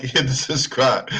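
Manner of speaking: slow words broken by laughter; laughing. Real, not acted. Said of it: "I can't stop laughing".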